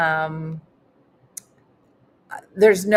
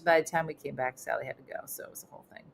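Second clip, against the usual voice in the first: usual speaking voice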